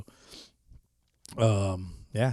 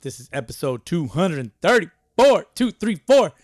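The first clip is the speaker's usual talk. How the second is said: hyped announcing; 6 semitones higher, 8 dB louder than usual. Real, not acted. Announcing the episode number.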